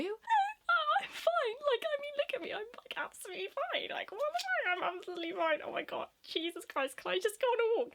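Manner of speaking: very high, squeaky near-crying insistence; acted hysterical denial. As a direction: insist frantically in a tearful squeal. Acted frantic denial to teacher.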